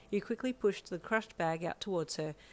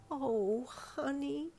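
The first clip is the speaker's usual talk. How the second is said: wobbly voice; 8 semitones higher, much slower than usual. Acted. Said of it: "she said in a wobbly voice".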